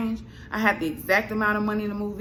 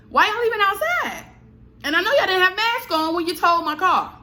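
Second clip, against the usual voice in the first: loud, high-pitched indignant rant; still upset; 10 semitones higher, 4 dB louder than usual. Real, not acted. Ranting at the tow company, loud and high indignation. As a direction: push it up high and loud, fuming. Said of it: "Still upset".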